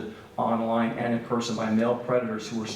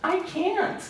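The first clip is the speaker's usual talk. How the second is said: put-on much higher, small child's voice; innocent little girl. Little Jessie answers the online stranger, innocent and high-pitched. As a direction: imitate a little girl's innocent reply. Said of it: "hear the innocence in our little Jessie's voice".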